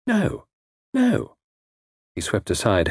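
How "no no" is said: startled protest. A narrator's reading. She protests his accusation.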